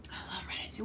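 soft whispered confession; whispering. Real, not acted. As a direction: whisper it back, quiet and fond.

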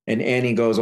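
ordinary delivery, nothing marked

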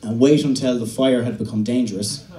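ordinary delivery

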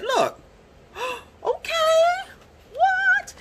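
high, breathless squeal of joy; squealing delight. Real, not acted. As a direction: gasp and squeak with excitement. Gasping delight at colours.

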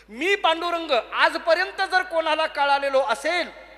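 loud, high, slow declaration; ringing, drawn-out pronouncement; grand proclamation. Acted. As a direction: announce it deliberately to an assembly. Dictating God's proclamation to the saints.

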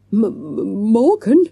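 startled stammer on the first sound, jumping high and loud; stuttering. A narrator's reading. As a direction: stutter the M, then blurt out the name. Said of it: "stuttered Sasha".

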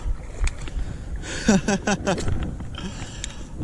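soft, breath-filled chuckle in a run; elated laugh. Real, not acted. Angler after letting the big fish go, elated laugh. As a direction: laugh quietly in relief.

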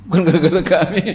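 mock the excuse through a laugh; laughing, quick mocking echo; laughing mockery. Mocking repeat of parents' excuse. (mengejek sambil tertawa)

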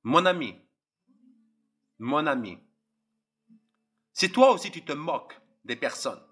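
normal talking voice

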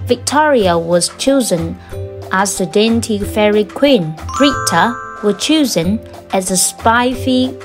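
plain reading voice

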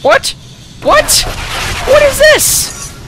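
shocked high cries of what; genuinely baffled outburst; surprised. Real, not acted. Stunned at strange game sight.

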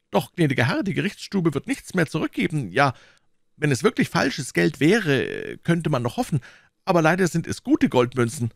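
high, anxious landlord voice; timid innkeeper. A narrator's reading. Innkeeper explaining the confiscated money to Athos, timid and high.

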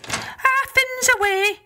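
high, lilting Irish wife; Una's voice. Acted. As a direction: sweetly put off the visitor in a high voice. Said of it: "she said".

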